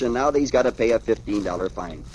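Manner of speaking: plain reading voice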